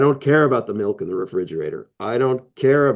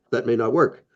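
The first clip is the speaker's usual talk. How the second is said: ordinary talk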